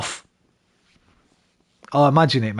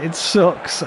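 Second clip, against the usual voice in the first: laughing; 5 semitones higher than usual. Real, not acted. Laughs at the controller.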